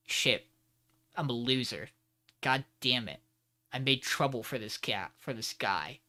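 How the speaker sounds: low, soft, glum self-reproach; retold dejection. Host voicing Dot blaming himself, mock depressed. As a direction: mope quietly, pausing between phrases. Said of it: "kind of over his depressive state".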